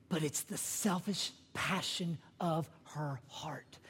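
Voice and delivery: slow, hushed whisper. Acted. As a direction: drop to a secret for emphasis. Sermon lowered to a whisper.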